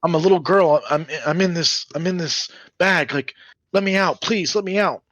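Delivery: put-on very high, small pleading child voice; little girl begging to be let out of a sack; younger little girl. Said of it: "she sounds younger".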